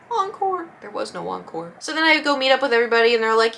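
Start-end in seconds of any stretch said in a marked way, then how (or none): 0.1-0.7 high, softer questioning word; small, pitched-up mock hopeful query; puzzled hoping. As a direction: ask meekly for more. Hopeful question after band leaves.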